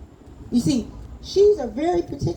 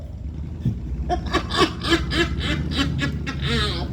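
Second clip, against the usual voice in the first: big hearty laugh; 3 semitones higher than usual. Acted. Baba laughing at his son's promise, big and hearty. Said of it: "Papa laughed".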